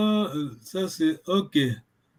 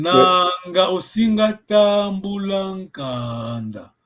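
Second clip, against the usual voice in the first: "Nanga Osinga Tambulankanda" very slow, long vowels held; slow careful reading; 4 dB louder, much slower than usual. Shown as a demonstration. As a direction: read each word slowly, stretching the vowels. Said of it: "la voix est longue".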